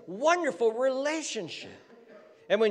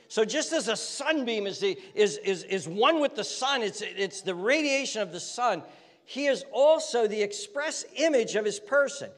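ordinary talk

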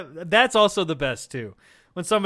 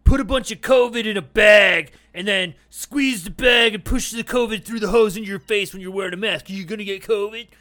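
mock argument; 2 semitones higher, 7 dB louder than usual. Mocking an anti-mask argument.

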